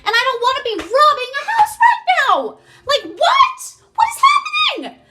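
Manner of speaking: high, loud, laughing disbelief; panicked shouting. Retold or imitated. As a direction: yell it half-laughing, unable to believe it. Panicked quote of herself robbing house.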